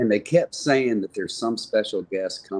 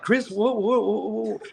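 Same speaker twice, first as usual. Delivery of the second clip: mock alarm; 13 semitones higher, slower than usual. Retold panic as fan collapses.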